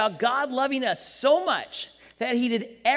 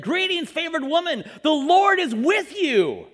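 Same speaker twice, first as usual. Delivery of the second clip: slow, ringing angel proclamation, a touch louder; grand angel greeting; 7 semitones higher, 7 dB louder, slower than usual. Acted. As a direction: announce it like a heavenly herald. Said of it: "Gabriel appeared to her and said".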